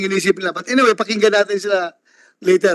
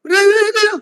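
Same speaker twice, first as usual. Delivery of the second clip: calming call; 11 semitones higher than usual. Real, not acted. Calming agitated crowd in hearing.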